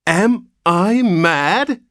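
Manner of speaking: slow, high and loud roar of rage; enraged father thunders at the nurse, high and slow; thundered. A narrator's reading. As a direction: thunder it out, terror turning to rage. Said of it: "thundered Mister Button".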